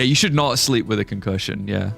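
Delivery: usual speaking voice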